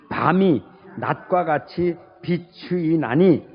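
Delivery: slow, measured reading of the verse, word by word; slow group scripture reading. Shown as a demonstration. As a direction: lead the congregation through the verse slowly. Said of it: "같이 읽읍시다".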